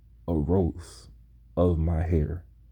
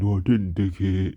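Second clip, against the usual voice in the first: louder parody of a monotone comeback; mocking imitation; 3 dB louder than usual. Classmate repeating his words back, mocking imitation. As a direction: parrot it back to make fun of him. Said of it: "people made fun of the way I talked".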